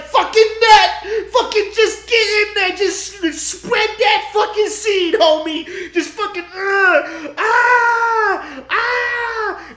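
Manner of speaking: high, loud hammed-up urging that ends in screams; hammed-up yelling. Grandmother pushing Jordan on, loud high yelling. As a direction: egg him on at the top of your lungs.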